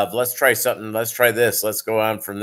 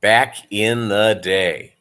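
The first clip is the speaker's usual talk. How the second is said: drawn-out wistful repeat; slow, knowing nod to the old days; slow nostalgic echo; slower than usual. Acted. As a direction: linger on it slowly, savoring. Echoing the phrase, savoring it.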